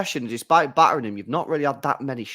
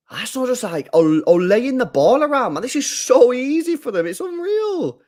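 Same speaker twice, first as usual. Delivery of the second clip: incredulous rant; 11 semitones higher, 4 dB louder than usual. Real, not acted. Fan ranting at easy Arsenal play.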